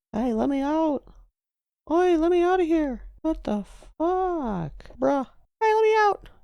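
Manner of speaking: high, squeaky cry to be let out; trapped captive yelling. Acted. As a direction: yell in a very high, put-on voice. Voices trapped figure banging on glass.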